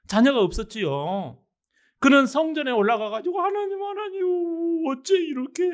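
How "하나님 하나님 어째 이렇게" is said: acting out a wailing prayer; mock tearful prayer. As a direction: plead upward in a high, lamenting voice. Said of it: "통곡하면서 기도할 때".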